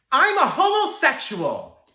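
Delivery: high, drawn-out shouted confession; loud outburst. Acted. Boy confessing in the principal's office, loud high outburst. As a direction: blurt it out at full volume, pitch soaring.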